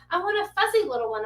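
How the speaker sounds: put-on high little-kid voice; pleading child. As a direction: imitate a kid wishing aloud, pitched high. Imitates child asking for puppy.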